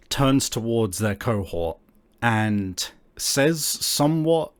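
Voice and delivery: normal talking voice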